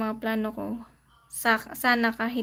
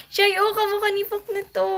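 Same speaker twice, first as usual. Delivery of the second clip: high and louder, delighted; excited squeal; 7 semitones higher, 5 dB louder, faster than usual. Real, not acted. A streamer shows her viewers the dog, excited and squealing. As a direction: point and squeal over the dog. Reacting to a cute dog photo.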